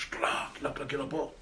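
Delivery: normal talking voice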